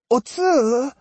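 put-on high, plaintive old woman calling out; earnestly poignant call. A narrator's reading. Osugi calls to the fleeing girl, earnestly poignant. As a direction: call to her earnestly, pitched right up. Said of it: "in an earnestly poignant tone".

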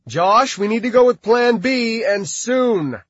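loud, high urgent call. A narrator's reading. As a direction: press the leader to change plans.

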